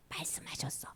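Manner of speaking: 속삭이듯 말하기; hushed storyteller whisper. A narrator's reading. 이야기 긴장감을 속삭임으로. (숨죽여 속삭이기)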